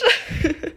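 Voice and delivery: a quick high burst of laughter. Real, not acted. Laughs after quote.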